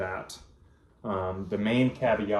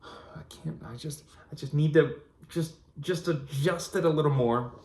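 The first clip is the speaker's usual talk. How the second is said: higher, mock-exasperated self-talk; hammed-up frustration fiddling with the dial; frustrated fiddling; 6 semitones higher than usual. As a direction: act fed up while tuning a radio. Mimics fiddling with radio dial.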